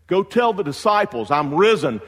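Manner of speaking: proclaiming. Pretend. Jesus' urgent command, preached loud.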